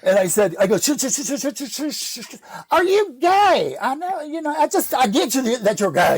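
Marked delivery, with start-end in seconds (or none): 0.8-2.3 rapid string of shushes trailing off; frantic shushing. Retold or imitated. Frantically shushing her in the meeting. 2.7-6.2 imitating loud woman. Woman pressing him in the meeting, imitated loud. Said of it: "she was very loud".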